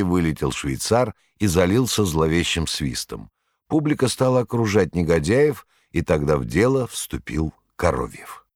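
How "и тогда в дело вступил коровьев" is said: ordinary delivery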